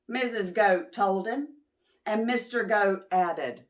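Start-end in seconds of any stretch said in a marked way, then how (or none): none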